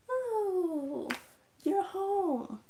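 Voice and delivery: high, loud put-on welcome; chirpy aunt greeting. Suddenly lively aunt to kid coming home, high loud greeting. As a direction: sing out a lively, drawn-out hello.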